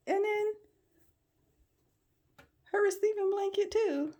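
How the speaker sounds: very high, incredulous squeal of a voice; amused disbelief. Real, not acted. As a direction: show off the mess in squeaky amazement. Showing the mess after laughing.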